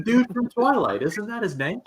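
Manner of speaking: puzzled. Real, not acted. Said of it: "No, it's Edward Collins".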